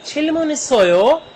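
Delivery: high, slow questioning Korean; teacher asking. Shown as a demonstration. Teacher asks students whether they have questions. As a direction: ask the class slowly and brightly.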